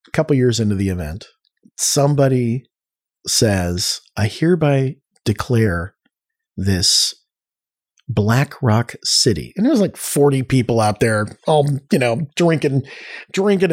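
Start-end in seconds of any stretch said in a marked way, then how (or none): 4.2-9.5 slow, spaced-out mock-solemn declaration; mock proclamation. Founder naming a desert camp, slow mock proclamation. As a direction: proclaim it slowly, pausing between phrases.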